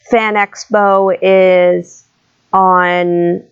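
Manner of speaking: ordinary talk, nothing marked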